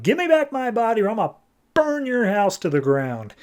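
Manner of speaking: hammed-up furious demand; mock raging. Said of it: "she's raging and she's like".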